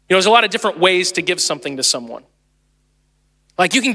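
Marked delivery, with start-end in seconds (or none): none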